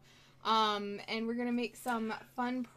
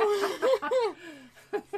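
loud laugh; 8 semitones higher, 7 dB louder than usual. Real, not acted. Laughing at own joke.

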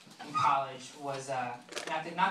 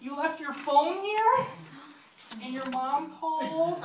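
high, drawn-out weepy voice; mock tearful girl; 11 semitones higher, much slower than usual. One-night stand about his forgotten phone, mock tearful. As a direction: stretch it out, pitched high as if crying. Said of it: "pretty much my, my crying. And she's like".

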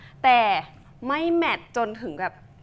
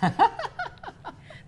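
high-pitched burst of laughter; real laugh; 12 semitones higher than usual. Real, not acted. Real laugh during product demo.

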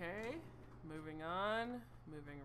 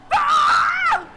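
sharp high scream of fright; startled scream; 27 dB louder than usual. Real, not acted. Player jumped by a monster, shrieking high. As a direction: scream as the jump scare hits. Jump scare in horror game.

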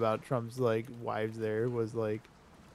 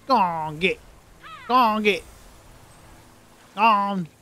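high, loud animal-herding holler; shouted shooing; 9 semitones higher, 10 dB louder than usual. Pretend. Player shooing a piggy out of the water, loud high shouts. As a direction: yell to drive a pig off.

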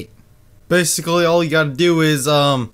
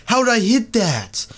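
high, slow fake amazement; mock disbelief; 7 semitones higher, slower than usual. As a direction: oversell the trick shot. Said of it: "be like".

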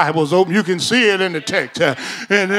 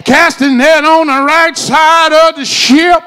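loud, high preaching shout; rising preacher's shout; shouted command; 6 semitones higher, 7 dB louder than usual. Acted. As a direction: belt the command out to the church. Repeated, shouted.

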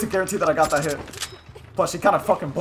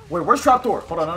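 normal talking voice

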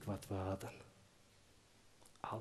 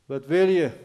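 much louder call from above; 4 semitones higher, 14 dB louder than usual. Acted. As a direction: shout down to the old lady. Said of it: "riep Benjamin toen hij haar zag". (luid naar beneden geroepen)

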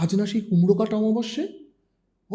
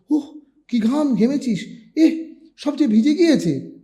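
higher, slow exclaiming mother; startled mother; 7 semitones higher, 4 dB louder than usual. A narrator's reading. Mother feeling her son's sweat, startled and high. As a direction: gasp at his sweat-soaked bedding. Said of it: "তাড়াতাড়ি বলে উঠল".

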